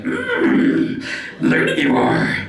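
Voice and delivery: very high, slow croaky little alien; Yoda. Retold or imitated. Yoda greeting his young lover, high and croaky. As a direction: squeeze it up like Yoda. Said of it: "So Yoda would be the same shit".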